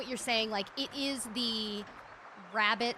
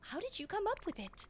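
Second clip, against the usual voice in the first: lightly raised, sweet thankful character; a mildly higher game character voice; 8 semitones higher than usual. Acted. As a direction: thank her brightly in a lifted voice. Voicing a game character thanking Freeze.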